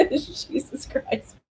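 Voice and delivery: helpless high laugh, words squeezed out. Real, not acted. Streamer cracking up at her own slip, laughing. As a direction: crack up and gasp it out slowly.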